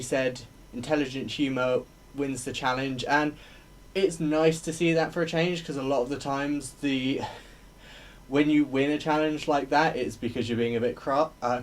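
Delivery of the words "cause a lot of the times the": ordinary delivery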